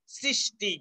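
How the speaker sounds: slow সৃষ্টি, starting on a hissed English "s"; dental s sound. Shown as a demonstration. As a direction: say সৃষ্টি as sri-shti, s first. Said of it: "এস ধ্বনির মতো".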